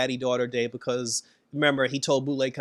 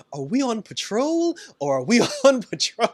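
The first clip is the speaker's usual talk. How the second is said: higher, louder, laughing through it; a giggly, higher mock Rita line; playful quip; 8 semitones higher, 4 dB louder than usual. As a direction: tease him with a giggle mid-line. Rita jokes in costume.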